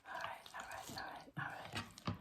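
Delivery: whispering to self. Real, not acted.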